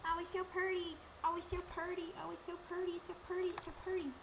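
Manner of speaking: high, gushing, sing-song repeats; mock swoon at her own dress. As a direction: coo over the dress. Showing her prom dress.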